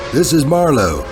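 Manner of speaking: plain reading voice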